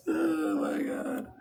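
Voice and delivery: high, slow, teary oh my God; wheezing out the words through tears of laughter; crying laughter. Real, not acted.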